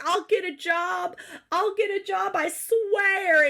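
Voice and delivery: high-pitched imitation of a desperate husband; mock crying plea. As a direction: beg and promise, hammed-up and tearful. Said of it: "crying to Nissa".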